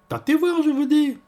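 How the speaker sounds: very high, loud demand; insistent concierge. Acted. Concierge ordering Orphée to feel, high loud insistence.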